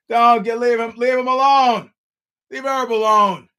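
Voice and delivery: loud, high-pitched plea; indignant shout. Real, not acted. Host defending Herb Dean to his critics, loudly indignant. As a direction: holler in the referee's defence.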